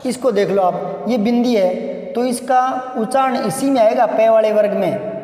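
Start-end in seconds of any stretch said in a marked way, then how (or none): none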